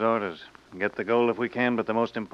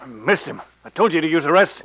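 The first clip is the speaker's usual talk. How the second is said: loud, raised-pitch scolding; angry yelling; 6 semitones higher, 9 dB louder than usual. Acted. Gunman blames his partner for the missed shot, angry yell. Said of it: "What are you yelling about?".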